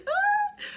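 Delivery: a sudden shriek of joy; can't contain the excitement; delighted fan squeal. Real, not acted.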